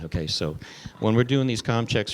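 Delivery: ordinary talk, nothing marked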